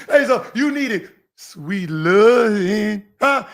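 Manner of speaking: loud, laughing mock lover's coo; mock sweet talk. Mocking Kwame with a coach's sweet whisper. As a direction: tease him with fake tenderness. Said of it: "whisper in his ear".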